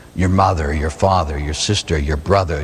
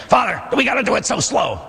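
high whiny complaining parishioner; whiny complainer; 13 semitones higher, faster than usual. Retold or imitated. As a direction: pitch it up like a grumbling churchgoer. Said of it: "they love to complain".

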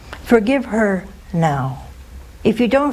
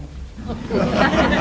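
a quick airy laugh; breathy laugh; 7 semitones higher than usual. Real, not acted. Laughs at mother's reaction.

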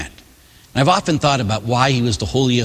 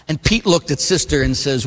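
normal talking voice

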